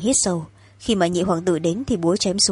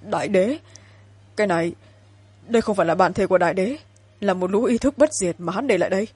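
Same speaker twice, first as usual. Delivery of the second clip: shaken disbelief. Acted. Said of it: "run lên vẻ khó tin".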